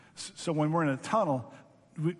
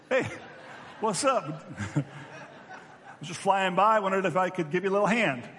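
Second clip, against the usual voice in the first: breezy, higher, laughing through the words; laughing casual Jesus; 4 semitones higher than usual. Pretend. Jesus knocking on the cockpit window, laughing and casual. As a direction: play a cheery drop-in visitor, chuckling.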